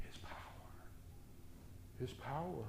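soft, awed His power; hushed. Acted. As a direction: let it fall to a whisper. Repeated hushed phrase.

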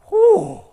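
loud, high-pitched whew; high whooping exhale of dismay; exaggerated whew. Shown as a demonstration. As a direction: let out a big, loud whew. Reacts to impossible standard.

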